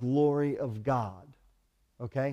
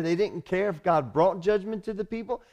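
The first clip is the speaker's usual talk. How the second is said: preaching; 6 semitones higher than usual. Acted. Preacher repeats with rising force.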